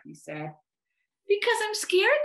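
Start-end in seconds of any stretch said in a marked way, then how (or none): 1.3-2.2 put-on very high, timid child; scared little voice. A narrator's reading. Little voice admits fear to the bear, timidly. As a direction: pipe up high and nervous. Small shy voice answering big bear.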